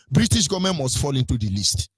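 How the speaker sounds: usual speaking voice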